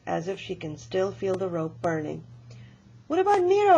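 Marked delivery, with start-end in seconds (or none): 3.1-3.8 high, loud, eager What about Nero; excited child blurting a question. Acted. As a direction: burst in with the question, much higher. Child eagerly interrupts the story.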